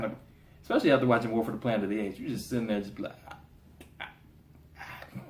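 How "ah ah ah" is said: faint choked gasps; mock strangled "ah", spaced out; imitated choking. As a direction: gasp as if your throat is cut. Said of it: "can't breathe".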